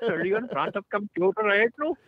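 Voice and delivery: high put-on call-centre accent voice; mocking a scammer's phone script; scam caller voice.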